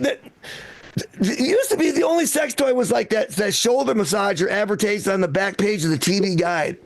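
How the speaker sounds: ordinary talk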